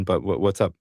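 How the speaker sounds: usual speaking voice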